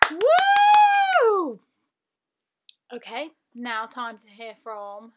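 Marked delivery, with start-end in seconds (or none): 0.1-1.6 high, loud, held "woo"; long celebratory shriek of woo; cheering whoop. Real, not acted. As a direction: whoop for them at full voice. Cheering the doctors.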